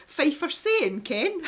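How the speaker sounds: high, sing-songy Fife accent. Shown as a demonstration. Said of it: "they've got very sing-songy accents".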